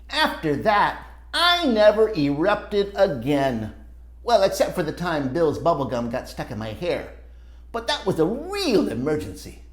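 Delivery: put-on lively read-aloud for kids; storybook narration. A narrator's reading. Reading a story to children, animated narration. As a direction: tell it like a picture book to children. Reads picture book aloud.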